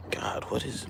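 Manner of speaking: whispered aside to self; whispering. Real, not acted. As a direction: breathe it under your breath. Muttered aside while struggling.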